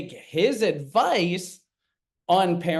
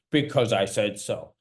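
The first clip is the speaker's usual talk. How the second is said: dismissive dad; 5 semitones lower than usual. Acted. Dad's stock reply, acted scene.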